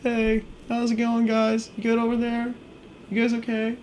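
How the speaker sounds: mock-innocent friends calling over; friends' sing-song check-in. Friends voiced, high and slow.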